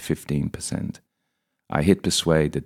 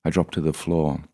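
ordinary delivery

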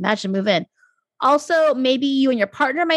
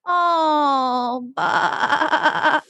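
put-on wavering bleat like a baby lamb; lamb impression; much slower than usual. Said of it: "That's, that's my lamb impression".

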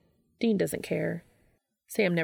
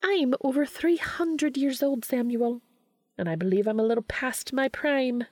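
withering; 6 semitones higher than usual. A narrator's reading. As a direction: put him in his place. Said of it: "withering glare".

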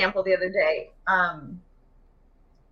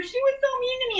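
put-on much higher whiny kid voice; upset child. A little girl tells mom a friend was mean, high and whiny. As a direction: complain like a wounded little girl.

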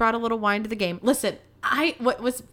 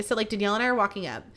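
ordinary talk

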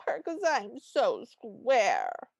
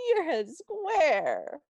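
doting tease; 6 semitones higher, 4 dB louder, much slower than usual. Retold or imitated. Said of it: "and she goes".

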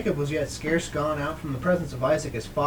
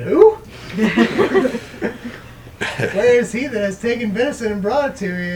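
startled cry, high and loud; shocked, urgent demand from a trembling old father; agitated Isaac; 7 semitones higher, 8 dB louder than usual. A narrator's reading. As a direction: blurt it out loud in alarm. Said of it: "Isaac trembled very exceedingly".

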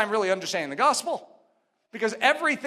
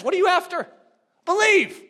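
higher and louder, ringing out Believe; fervent altar-call cry; preaching call; 8 semitones higher, 6 dB louder than usual. Acted.

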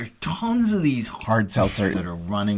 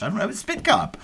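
high protest; 13 semitones higher than usual. Real, not acted. Friend overpours her drink.

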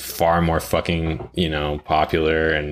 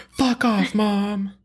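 put-on high bratty teenage girl; much slower than usual. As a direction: snap back like a bratty teen.

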